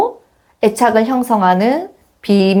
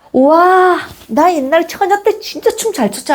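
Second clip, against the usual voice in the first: higher, gleeful boasting mom; excited mom; 8 semitones higher than usual. Retold or imitated. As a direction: brag like a mom showing off her moves. 엄마가 신나서 일어나 춤춤. (신나서 자랑하는 엄마 흉내, 높은 목소리)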